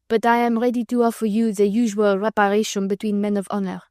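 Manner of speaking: put-on broken English with a light French accent; stiff, proud young Frenchman in a French accent. Young Frenchman challenging Sir Percy, French accent. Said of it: "speaking in broken English".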